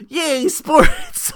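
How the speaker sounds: high, loud mock-enthusiastic yay; mock cheer for a sports feud she doesn't care about; sarcastic cheer. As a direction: fake excitement you clearly don't feel.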